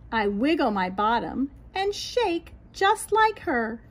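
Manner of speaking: lilting, sing-song storybook read; reading to children. A narrator's reading. Teacher reading a picture book to children, lilting. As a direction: read it aloud to little ones, bright and rhythmic.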